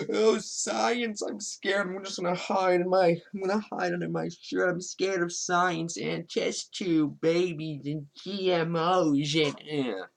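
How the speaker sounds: high, whiny mock-terrified voice; hammed-up scaredy-cat cowering from science, dragging out the last words; mock fear. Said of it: "I hate this sort of fear".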